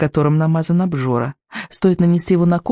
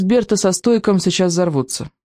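ordinary delivery

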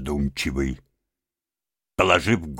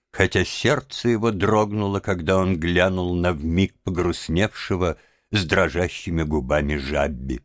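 usual speaking voice

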